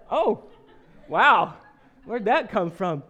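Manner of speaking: mock amazement at the kid's remark; surprised. Dad reacting to his child's outburst, surprised.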